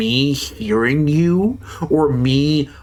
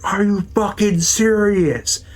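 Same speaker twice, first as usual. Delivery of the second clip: higher-pitched angry yell, replayed; half-restaged screaming match, pitched up; retold furious yelling; 8 semitones higher than usual. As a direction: hurl it back at the coworker, furious. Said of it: "I started screaming at my coworker".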